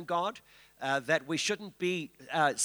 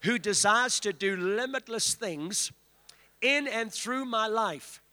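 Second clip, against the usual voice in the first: leading a declaration; 3 semitones higher than usual. Shown as a demonstration. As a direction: lead the congregation's declaration. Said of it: "lift your hands and say".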